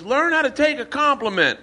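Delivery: high put-on voice of the snubbing girl; lightly mocking high woman's tone; mock prissy girl voice. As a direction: squeak it out like an offended woman. Friend mocking girl's retort.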